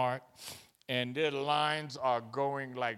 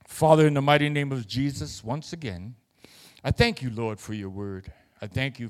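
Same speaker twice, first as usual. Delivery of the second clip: prayer; 2 semitones lower, 4 dB louder than usual. Real, not acted. Closing prayer over congregation.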